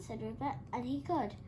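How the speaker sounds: usual speaking voice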